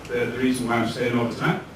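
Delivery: ordinary talk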